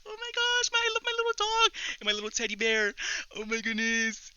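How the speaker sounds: put-on squeaky high baby voice, fast; gushing over dog. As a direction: coo like hugging a puppy. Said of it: "I was just like".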